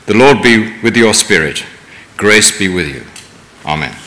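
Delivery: ordinary delivery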